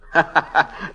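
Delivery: short amused laugh with a rising end; scoffing little laugh. Acted. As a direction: laugh at the very idea. Amused at catfishing.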